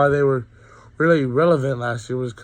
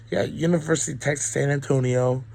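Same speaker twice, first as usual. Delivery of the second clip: ordinary talk, nothing marked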